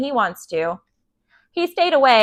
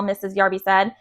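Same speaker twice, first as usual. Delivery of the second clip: ordinary delivery, nothing marked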